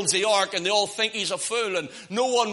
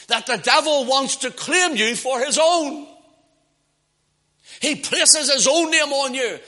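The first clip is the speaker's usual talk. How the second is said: raised, forceful sermon delivery; preaching; 5 semitones higher, 6 dB louder than usual. Real, not acted. Preacher warning how the devil claims you, forceful preaching.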